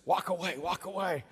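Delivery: ordinary talk, nothing marked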